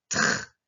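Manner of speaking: TR as T running into a held R; drawn-out TR sound; blended consonants. Shown as a demonstration. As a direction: blend T and R into TR, no vowel after. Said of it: "Les deux ensemble".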